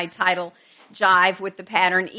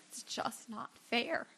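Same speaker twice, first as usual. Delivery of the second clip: a hint of a daughter's tearful voice, much softer; imitating teary daughter; 18 dB quieter than usual. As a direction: softly echo her daughter's tears, understated. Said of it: "in her face and in her voice".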